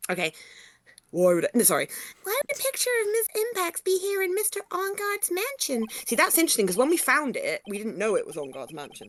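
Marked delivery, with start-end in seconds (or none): 2.2-5.9 put-on high-pitched girl voice; character voice. Player retakes the girl's line, high-pitched. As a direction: repeat the girl's line in her voice. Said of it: "Do you want to say it again?".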